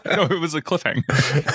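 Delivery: real laughter breaking into the words; laughing. Real, not acted. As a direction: try to carry on while cracking up. Both cracking up over cliffhanger.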